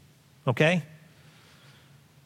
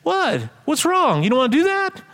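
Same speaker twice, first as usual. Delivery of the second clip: high-pitched incredulous questioning; surprised chicken; 13 semitones higher, 3 dB louder than usual. Acted. Chicken questioning the reluctant pig, high surprise. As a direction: shoot the pitch way up in disbelief.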